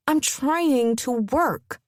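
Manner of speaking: slow words through clenched teeth; through gritted teeth. Acted. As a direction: push it out past gritted teeth. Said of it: "she replied through gritted teeth".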